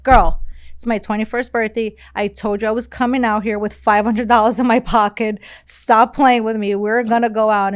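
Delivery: loud, insistent scolding of her friend; firm, exasperated push to go out; retold telling off.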